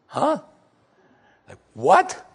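high, puzzled double take; a young girl caught off guard, half laughing in disbelief; preacher voicing Mary's confused reply; baffled Mary. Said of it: "And Mary's response had to be".

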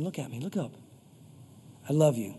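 low, loving voice of comfort; tender reassurance. Acted. God lifting a bowed-down listener's chin, tenderly reassuring. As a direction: reassure gently in a low register.

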